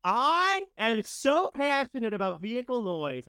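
loud, high and slow, full of passion; a loud, high burst of real enthusiasm; said very loudly. Real, not acted. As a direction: belt it out about vehicle noise. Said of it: "I just said it very loudly".

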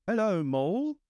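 high, lilting Water Rat greeting. A narrator's reading. Water Rat greets Mole across the river, high and lilting. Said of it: "said the water rat".

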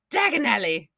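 "Dagonelli" with a flat A, stress on "nel"; quick mock of "Dagonelli"; mispronounced name. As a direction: mimic the actor saying "Dagonelli" in place of the name. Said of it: "but when he goes".

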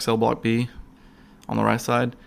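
ordinary talk, nothing marked

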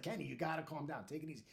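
soft, settling down at the end; retold soothing captain; calming. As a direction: talk down an angry colleague quietly.

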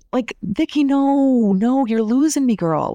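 a real outburst of no, no; exasperated. Real, not acted. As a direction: push back, fed up with her. Reacting to Vicki on screen.

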